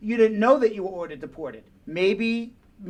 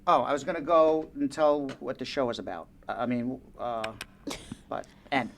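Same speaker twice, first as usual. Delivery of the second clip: low, soft, halting and unsure; nervous; 8 semitones lower, 6 dB quieter than usual. Real, not acted. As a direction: fumble quietly for what to say. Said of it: "the impression that you're nervous".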